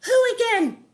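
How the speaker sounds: squeaky confused snake; Wanda as snake. Acted. New snake answers Wart, high and puzzled. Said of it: "said the snake".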